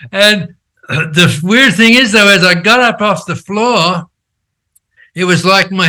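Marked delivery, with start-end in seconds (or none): none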